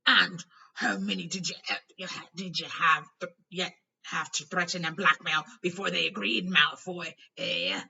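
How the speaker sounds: loud raging shout; roar. A narrator's reading. Hagrid accusing Malfoy, a loud roar. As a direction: bellow the accusation. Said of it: "he roared".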